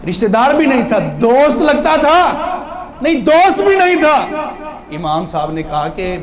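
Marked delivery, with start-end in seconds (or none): 0.1-4.3 insistent questioning. Acted. Preacher questions the young man.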